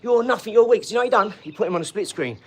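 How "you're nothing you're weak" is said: higher, louder imitated put-down; slagging off. Retelling a geezer slagging off his mate, scornful. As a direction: imitate someone hurling insults at a rival. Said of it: "slagging him off".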